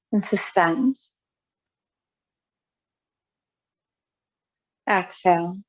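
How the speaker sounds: spare breathing cues, spaced by long silences; guided breathwork. Real, not acted. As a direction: pace their breath with calm, measured cues. Leads timed breathing practice.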